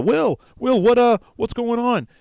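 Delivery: high, loud party shout; shouting over music. Retold or imitated. Charles answering the phone at a loud party, high loud shout. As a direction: yell over the thumping house music.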